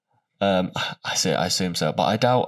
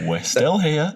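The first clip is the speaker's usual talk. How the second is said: high, louder teasing echo; mock catchphrase; 8 semitones higher, 4 dB louder, slower than usual. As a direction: parrot his catchphrase. Mocking co-host's catchphrase.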